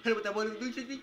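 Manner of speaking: high, loud hype call; hyped shout. Real, not acted. Gamer hyping his own trick, loud and high. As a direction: shout it like a big play.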